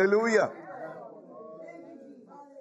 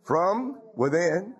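slow emphasis; much slower than usual. Acted. Slow emphatic preaching point.

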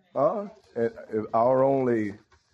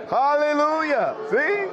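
hallelujah flung up high and loud; shouted hallelujah; 13 semitones higher, 3 dB louder, slower than usual. Shown as a demonstration. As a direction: ring out the hallelujah like a preacher. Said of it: "I can say hallelujah too".

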